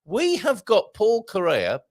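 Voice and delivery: very high, loud delighted cry; excited exclamation. Real, not acted. Host thrilled to see a guest, high and loud. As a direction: gush over the guest arriving.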